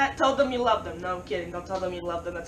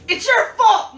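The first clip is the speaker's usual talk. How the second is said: loud, high joking shout; sudden comic outburst of blame; mock accusation; 9 semitones higher, 11 dB louder, slower than usual. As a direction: yell it as a playful accusation. Joke accusation, then says kidding.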